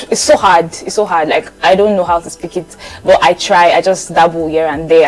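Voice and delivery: ordinary talk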